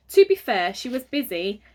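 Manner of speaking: ordinary delivery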